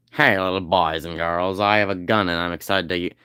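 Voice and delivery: a hint of a Southern villain voice; southern accent. Streamer mocking a game voice line in a southern accent. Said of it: "is that a southern accent?".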